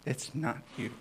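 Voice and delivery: said back to Jesse with a grin; playful repeat. Real, not acted. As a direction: tease it gently. Repeated line after a laugh.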